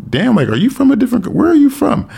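very high-pitched, puzzled questioning; mock-amazed, pitch shooting up; retold astonishment. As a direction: imitate people surprised and curious. Retells people puzzled by accent.

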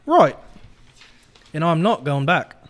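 put-on loud, emphatic British-accented refusal. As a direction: stand firm in a British accent. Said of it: "in a British accent".

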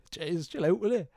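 usual speaking voice

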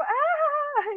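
high mock yell of a jeering classmate; a high teasing "Ah!" shout. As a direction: yelp it out high, teasing the boy.

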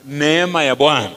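normal talking voice

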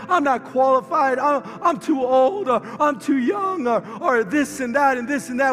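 loud, high preaching at full force; fiery preaching. Acted. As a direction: preach it out to the congregation.

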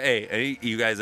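normal talking voice

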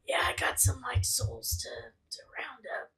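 hoarse breathy whisper; whispered raspy voice. Acted. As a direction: rasp it out in a whisper. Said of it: "It's okay".

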